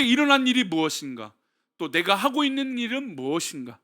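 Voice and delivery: solemn, intoned blessing over the congregation; benediction. Acted. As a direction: pronounce the blessing steadily. Benediction prayer. (엄숙한 축도)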